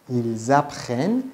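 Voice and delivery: "Ils apprennent" slowly, with a clear z linking the words; liaison Z. Shown as a demonstration. Teacher showing the z students hear, slow liaison in "Ils apprennent".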